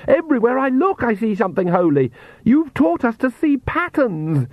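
very high-pitched anguish; distraught outcry. Acted. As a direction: accuse in rising horror, pitch way up. Distraught girl accusing her father.

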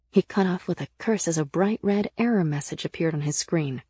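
usual speaking voice

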